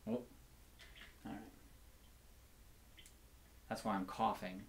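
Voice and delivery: usual speaking voice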